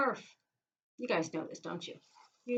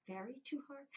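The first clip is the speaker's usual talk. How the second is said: "Very too hard?" asked back, higher and much softer; puzzled question; 5 semitones higher, 11 dB quieter than usual. Shown as a demonstration. Teacher musing aloud to kids, puzzled. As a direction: quiz yourself on the odd phrase. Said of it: "That is very too hard".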